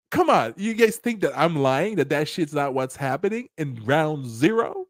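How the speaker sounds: ordinary talk, nothing marked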